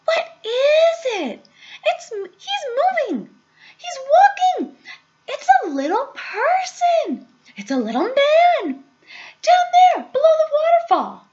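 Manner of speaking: high, loud excited shrieks; screaming. A narrator's reading. Girl spotting a tiny man, screaming. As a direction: shriek each phrase in amazement. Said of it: "she screamed".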